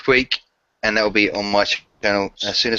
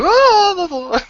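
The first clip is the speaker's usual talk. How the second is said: very high, loud imitated squeal; mock emotional "oh!"; 5 dB louder than usual. Teasing a friend who gets emotional, mock high cry. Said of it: "You do that all the day. When you get emotionally".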